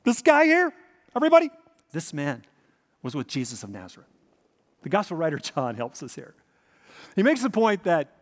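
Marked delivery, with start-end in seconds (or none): 0.0-4.1 loud, high, insistent pointing out; adamant accusation. Retold or imitated. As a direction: call him out before everybody. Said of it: "in a more adamant tone".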